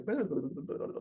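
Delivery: soft burbling dribble noise; quiet, tumbling mouth noise of oozing yolk; imitated egg trickle. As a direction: babble softly like egg running down a head. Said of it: "crack an egg on someone's head and it goes".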